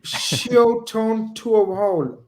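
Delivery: very slow, high Irish accent. Shown as a demonstration. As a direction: stretch "Shioton Tuohol" out slowly in an Irish accent. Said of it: "I didn't do a bad effort there".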